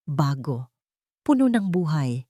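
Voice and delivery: plain reading voice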